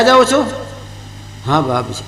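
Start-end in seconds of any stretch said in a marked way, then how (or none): 1.5-2.0 low, quiet, bowed-head reply; meek disciple. Acted. Disciple answering his guru, low and meek. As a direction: answer softly like a guilty pupil.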